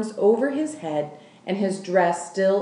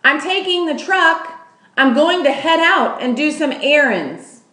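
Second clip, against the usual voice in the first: loud, higher announcement; shouting; 8 semitones higher, 7 dB louder than usual. Acted. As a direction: call it out on the way out the door. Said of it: "Lolly shouted".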